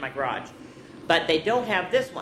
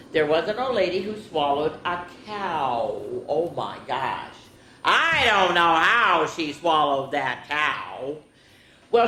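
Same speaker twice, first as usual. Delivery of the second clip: lively storybook read-aloud; reading to children; 3 dB louder than usual. Acted. Teacher reading a picture book to children, lively. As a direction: read it out to the kids with bounce.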